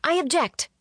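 high-pitched objection called out; calling out. A narrator's reading. Faye interrupts a wedding from the pews, calling out. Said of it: "Faye called out".

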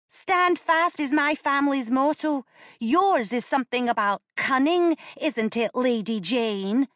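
put-on a bold girl throwing the family motto back; taunting child. A narrator's reading. Janet taunting Lady Jane, high defiant child voice.

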